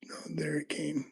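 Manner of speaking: soft whisper as the pin drops; whispered aside. Real, not acted. Picker whispering to himself as the lock gives. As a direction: breathe it out to yourself. Pin sets while picking.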